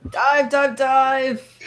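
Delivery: loud, high warning shout; high, loud alarm cry; urgent call-out. Acted. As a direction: yell for everyone to take cover. Player calls out as cans fly.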